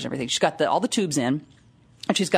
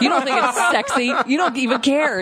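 whiny accusation; 7 semitones higher than usual. Retold or imitated. Accusing wife, mock upset.

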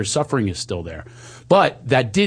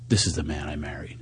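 low, soft whispered quote; whispering ex-wife; 8 semitones lower, 8 dB quieter than usual. Retold or imitated. Retelling his crying ex-wife's words, whispered low. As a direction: hush it as the tearful ex-wife. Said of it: "she's crying".

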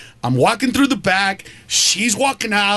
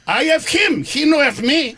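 normal talking voice

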